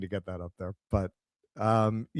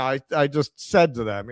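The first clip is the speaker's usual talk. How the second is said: ordinary talk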